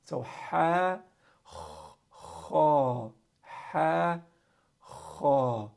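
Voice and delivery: ha breathy, kha scraped from the top of the throat; ha and kha drill. Shown as a demonstration. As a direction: contrast ha and kha. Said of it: "spend some time on these letters".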